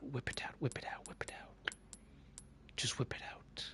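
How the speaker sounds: fading whisper, barely voiced. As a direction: breathe it out like a naughty secret. Repeated whispered punchline.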